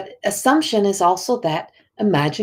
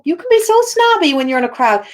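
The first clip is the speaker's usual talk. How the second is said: mocking accuser; 9 semitones higher, 4 dB louder than usual. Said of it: "people say".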